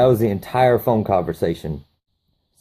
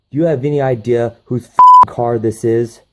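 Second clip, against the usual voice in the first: angry scolding; 3 semitones higher, 7 dB louder than usual. Retold or imitated. Owner scolding the thug.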